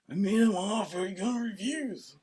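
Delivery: softened attempt at the Godfather voice; mob boss impression. Retrying the Godfather line for co-host, soft light impression. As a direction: lightly imitate the don, quietly. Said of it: "Can you do it?".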